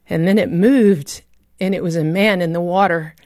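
usual speaking voice

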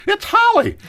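stunned, delighted discovery; surprised exclamation. Retold or imitated. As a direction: blurt out her name in amazement. Recognizing daughter at seminar.

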